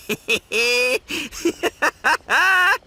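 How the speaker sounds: high giggle growing into a loud laugh; pleased laugh. Acted. Calling himself his hombre's equal, pleased laugh. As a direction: let a high chuckle build into a big laugh.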